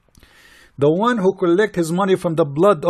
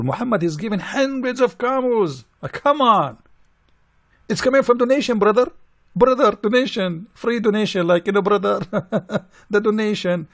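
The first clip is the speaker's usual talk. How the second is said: higher, sneering "brother, donation" voice; mocking pious voice; 6 semitones higher than usual. Mocking followers who call the camels a donation, sneering. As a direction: ridicule the excuse in a put-on believer's tone, with a laugh.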